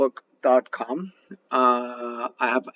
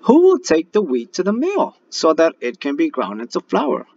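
higher, louder hen call; hen asking; 6 semitones higher, 8 dB louder than usual. Acted. Little red hen asking the animals, higher louder voice. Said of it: "asked the little red hen".

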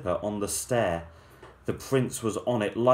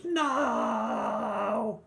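screaming; 12 semitones higher than usual. Acted. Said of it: "The prince screamed".

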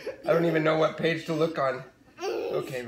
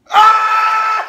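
very high, loud shriek into laughter; scream, then collapse into squealing laughs; excited scream; 8 dB louder than usual. Real, not acted. Parent seeing the next page, screaming with laughter.

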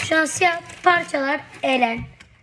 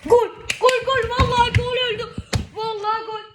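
high, loud goal screams; screaming football commentator; 11 semitones higher, 4 dB louder, faster than usual. Real, not acted. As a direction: yell it like a goal call. Goal scored during match.

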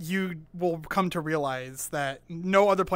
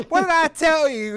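put-on thick Southern accent; 9 semitones higher, 6 dB louder than usual. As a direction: scold in an exaggerated Southern twang. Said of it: "his southern accent had a southern accent".

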